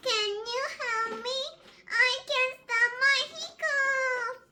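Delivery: high, whimpering little pig; whiny plea. Acted. Little Pig begging his siblings for help, high whimper. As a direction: plead high with a half cry. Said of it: "says Little Pig".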